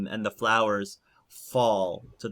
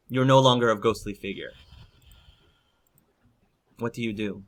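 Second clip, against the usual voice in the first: ordinary talk, nothing marked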